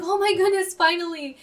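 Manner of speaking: high, loud retold burst of relief; elated relief. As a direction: exclaim it like long-awaited good news. Acts out own relieved reaction.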